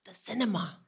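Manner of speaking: soft, hushed answer; secretive little reply; imitated whisper. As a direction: whisper it as the son on the phone. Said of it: "Why am I still whispering".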